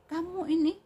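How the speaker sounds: very high, loud reproach; harsh scolding. Shown as a demonstration. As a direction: burst out at the child as a demonstration. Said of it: "Beda kan?".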